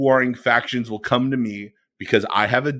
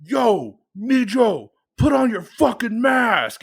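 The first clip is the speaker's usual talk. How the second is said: higher, louder mock callout; retold angry callout; 10 semitones higher, 4 dB louder, slower than usual. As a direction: bark it like a shot across the bow, higher. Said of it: "a shot across the bow".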